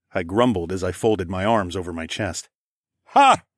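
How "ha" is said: sudden high, loud bark of laughter; bursting laugh. Acted. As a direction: let the laugh burst out of you. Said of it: "he burst out".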